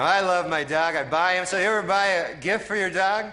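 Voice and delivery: normal talking voice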